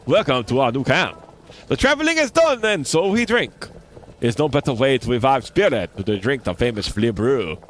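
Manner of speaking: put-on lightly French-accented flea brewer offering a drink. Flea brewer offering Flea Brew to the guest, French accent. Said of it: "That's my attempt at a French accent".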